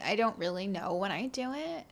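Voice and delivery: normal talking voice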